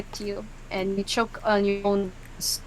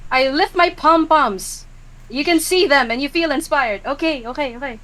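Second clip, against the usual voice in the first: cheerleader; 5 semitones higher, 5 dB louder than usual. Acted. Cheerleader lifts pom-poms.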